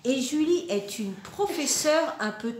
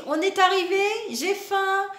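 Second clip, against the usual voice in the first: put-on whiny child; 7 semitones higher, 4 dB louder, faster than usual. Child voiced whining in story.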